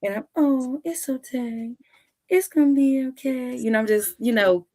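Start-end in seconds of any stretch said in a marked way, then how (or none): 0.4-3.6 put-on soothing a baby. Aunt soothes the hoarse crying baby, higher lulling voice. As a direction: coo it like calming a screaming newborn. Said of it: "lulling him".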